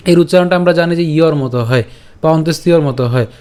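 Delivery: normal talking voice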